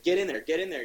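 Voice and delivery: mock urgent yell. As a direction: hurry a friend along in a mock shout. Ollie urging Hal, tense scene.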